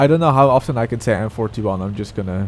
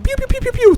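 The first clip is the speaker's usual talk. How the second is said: high-pitched rapid pew-pew noises; quick string of high mouth-made shots; imitated rapid gunfire; 5 dB louder, faster than usual. Shown as a demonstration. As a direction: mimic a fast-firing little gun with your voice. Imitating rapid-firing gun.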